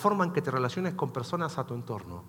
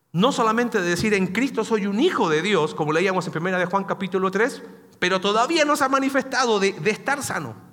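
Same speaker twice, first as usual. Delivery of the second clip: louder and higher, driving the point; full force preaching; 4 semitones higher, 6 dB louder than usual. Real, not acted. Pastor telling the church about healing, full force preaching. Sermon climax.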